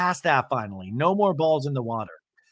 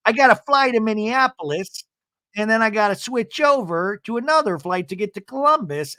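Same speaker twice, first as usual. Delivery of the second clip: high-pitched excuse-making imitation; Seth, making excuses; 7 semitones higher than usual. Seth justifying his flights, high mimicry. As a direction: pitch up and labor through the itinerary. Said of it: "And Seth goes".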